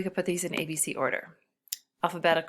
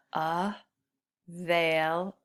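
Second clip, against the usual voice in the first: "Available" drawn out very slowly, syllable by syllable; slowly stretched out; much slower than usual. Shown as a demonstration. Teacher counting syllables by slowing the word down. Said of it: "That's another four".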